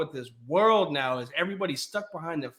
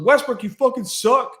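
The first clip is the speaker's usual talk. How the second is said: higher, louder jeering heckle; mock heckle; 7 semitones higher, 5 dB louder than usual. Same fan heckling Westbrook as he walks by, jeering yell. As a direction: taunt him from the stands.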